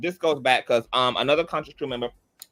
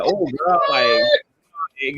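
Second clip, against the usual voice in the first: disgusted reaction; 2 semitones higher, 4 dB louder, much slower than usual. Retold or imitated. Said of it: "I was a green face emoji".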